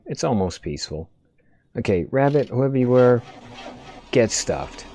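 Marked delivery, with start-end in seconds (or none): none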